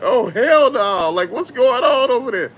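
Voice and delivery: loud, high-pitched disbelief; retold shocked outburst; mock outrage. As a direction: call out like an alarmed bystander, drawing out the first words. Retelling bystanders' shocked reaction.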